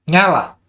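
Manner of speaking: testing the trained Nyala command; called command. Shown as a demonstration. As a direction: bark the switch-on word at the module. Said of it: "lebih intonasinya lebih dikenal kalau nyala mati".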